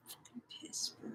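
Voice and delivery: whispered irritated curse. Real, not acted. Annoyed with the task, whispering to herself. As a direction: hiss the curse under her breath.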